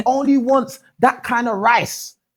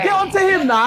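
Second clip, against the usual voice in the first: urging shout; 8 semitones higher than usual. Real, not acted. Host urging panel to challenge.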